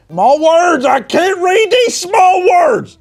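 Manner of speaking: high, loud, drawn-out fake cry over small print; hammed-up howl of despair at the label; mock anguished wail. Squinting at a cider label, a mock anguished high wail.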